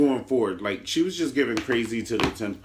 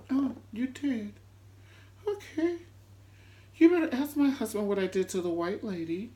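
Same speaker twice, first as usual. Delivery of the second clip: mock sweet threat; 10 semitones higher than usual. [name] answering Erica about divorce papers, mock sweet. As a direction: purr it like a menacing lady.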